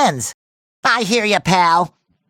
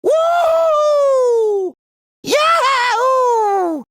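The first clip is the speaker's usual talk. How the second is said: long, high cartoon whoops; joyful whooping; 10 semitones higher, much slower than usual. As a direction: whoop with wild delight. Celebratory whoops.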